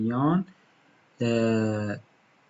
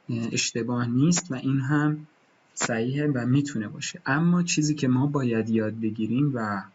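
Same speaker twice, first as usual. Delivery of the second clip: normal talking voice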